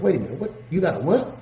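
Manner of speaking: taken aback, questioning; rising, disbelieving "what?"; incredulous. Retold or imitated. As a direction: stop them short in disbelief. Stops friend, disbelieving the car note.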